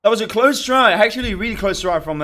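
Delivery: loud hyped reaction, voice catching; excited. Real, not acted. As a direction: blurt it out loud, throat tight. Hyped reaction after round.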